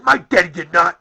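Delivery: put-on a loud, indignant child's denial; a son loudly defending his dad. Retelling how son Kyle defended him, loudly indignant. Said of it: "He was indignant".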